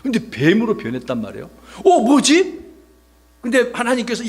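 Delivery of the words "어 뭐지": high, louder startled question. Acted. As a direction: act baffled, pitch jumping up. 지팡이가 뱀이 된 놀람. (놀라서 어리둥절한 목소리)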